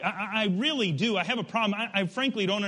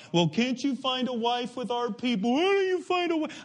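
high-pitched mocking whine, climbing higher; whining; 6 semitones higher than usual. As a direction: whine it like a little sissy, rising to a squeal. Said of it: "whining about".

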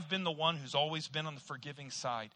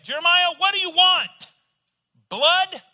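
high, loud scolding mother; exasperated mom; 10 semitones higher, 12 dB louder than usual. Retold or imitated. Mom scolding her complaining son, high and loud. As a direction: snap at the whining son with a loud, high mock rebuke. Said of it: "my mom would look to me and say".

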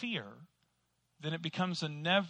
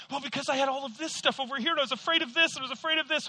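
excuse-making; 10 semitones higher, 5 dB louder, much faster than usual. Retold or imitated. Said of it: "Why didn't you ever ... serve God".

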